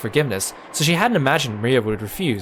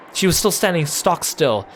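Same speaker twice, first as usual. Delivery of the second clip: plain reading voice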